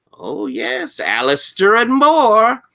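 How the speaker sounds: very high, relishing librarian; Doctor Pritchard. Acted. Librarian tempting an awed student, high and relishing. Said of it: "Pritchard looking interested".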